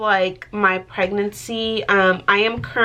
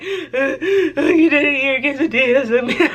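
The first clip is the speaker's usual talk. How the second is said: higher, louder whine, half laughing through fake sobs; mock bawling; 5 semitones higher, 4 dB louder than usual. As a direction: replay the tearful complaint, laughing at yourself. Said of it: "but, like, bawling".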